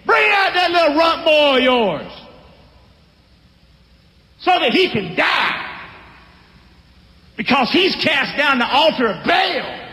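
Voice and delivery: the men of the city demand that Joash give up his son, shouting; furious mob demand. Acted. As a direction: yell it like a lynch mob.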